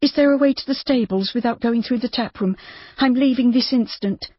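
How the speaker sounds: high, urgent demand; Judy, urgent. A narrator's reading. Judy asking the landlord for the stables, high and urgent. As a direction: she needs out this instant.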